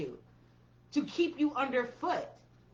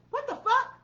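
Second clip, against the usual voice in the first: outraged disbelief; 11 semitones higher than usual. Real, not acted. Outraged exclamation at viewers.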